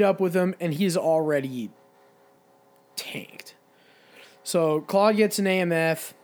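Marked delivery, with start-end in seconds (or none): 2.9-3.6 whispered. Real, not acted. Drunk friend revealed, dropped to whisper.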